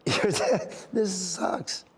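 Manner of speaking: choked-up little laugh; embarrassed at really crying, laugh through it; fighting happy tears. Real, not acted. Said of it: "I'm starting to cry now".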